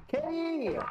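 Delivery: calling a cat. Real, not acted.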